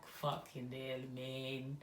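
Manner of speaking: low, soft, stunned under the breath; awed mutter. Retold or imitated. Retelling her awed thought at the necklace, low and soft. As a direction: swear quietly to yourself in awe. Heart racing as necklace appears.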